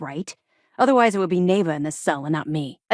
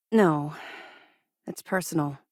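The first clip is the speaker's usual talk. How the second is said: ordinary talk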